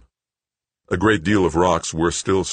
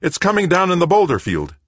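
urgent shout; 6 dB louder than usual. A narrator's reading. Brenard urgent warning.

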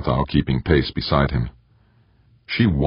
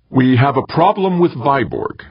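pitched up high and louder, announcing it to him; like an announcement; 11 semitones higher, 4 dB louder than usual. A narrator's reading. Morgenthau warns Eberly about Viborg, announcing it loud and high. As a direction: declare it like formal news. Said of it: "as if making an announcement".

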